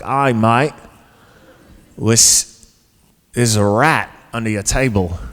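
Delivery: put-on Australian accent. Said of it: "this Australian dude leans over and he's like".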